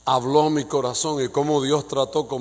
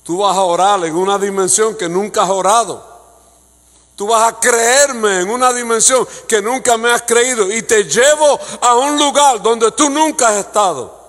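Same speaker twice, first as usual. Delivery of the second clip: much louder, higher, slowing at the end; full-force preaching; 8 semitones higher, 11 dB louder than usual. Acted. God promising the pastor new heights, preached at full force. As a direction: proclaim the promise with rising power.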